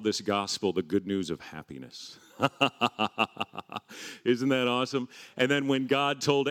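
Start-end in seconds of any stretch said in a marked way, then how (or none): 2.4-4.2 short rolling run of chuckles; joyful laugh. Real, not acted. Preacher delighted at good news of happiness, laughing.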